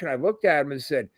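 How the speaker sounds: ordinary talk, nothing marked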